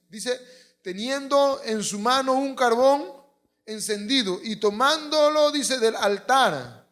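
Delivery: loud, high preaching, slowed for weight; preaching at full force. Acted. Preacher proclaiming the seraph scene, loud and high. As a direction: proclaim it to the congregation.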